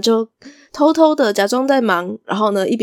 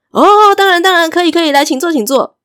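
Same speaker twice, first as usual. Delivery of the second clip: eager welcome; 8 semitones higher, 7 dB louder than usual. Acted. Shop owner welcomes returning guests.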